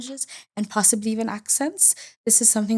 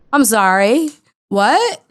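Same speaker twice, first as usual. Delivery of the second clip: louder, sharp and incredulous; an indignant, louder I'm sorry, what; indignant retort; 4 semitones higher, 8 dB louder, much slower than usual. Acted. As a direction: fire it back in disbelief. Retorting to an ignorant remark.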